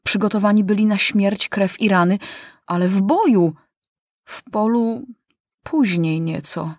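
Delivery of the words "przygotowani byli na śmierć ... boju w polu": plain reading voice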